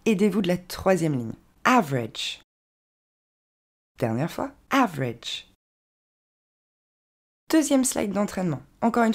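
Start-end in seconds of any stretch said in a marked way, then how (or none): 1.6-2.4 AVERAGE in two syllables, "AV-redge"; correct English pronunciation. Shown as a demonstration. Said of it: "il n'y en a que deux". 4.7-5.5 AVERAGE in two syllables, "AV-redge"; correct English pronunciation. Shown as a demonstration. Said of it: "il n'y en a que deux".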